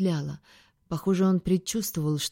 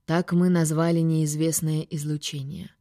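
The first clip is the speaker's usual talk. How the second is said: usual speaking voice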